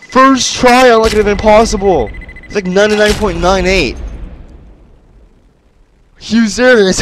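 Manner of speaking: high, loud disbelieving shouts after winning; real excited yelling. Real, not acted. Gamer beats level first try, shouting high and loud. Stunned by beating impossible level.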